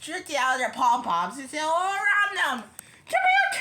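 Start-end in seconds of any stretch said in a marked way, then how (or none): 3.1-3.6 very high, loud cheerleader chant; piercing pep-rally shout; shouted cheer. A narrator's reading. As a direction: yell it out to the whole stadium. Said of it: "shouted the cheerleaders".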